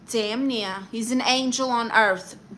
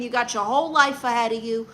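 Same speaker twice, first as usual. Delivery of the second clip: ordinary talk, nothing marked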